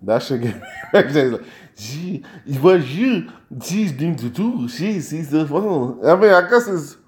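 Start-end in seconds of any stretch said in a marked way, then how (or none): 1.8-6.0 gibberish imitation of Portuguese; badly faked Brazilian chatter, drawn out; mock Brazilian Portuguese. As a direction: imitate Portuguese with nonsense syllables. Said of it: "Portuguese mixed with African or something".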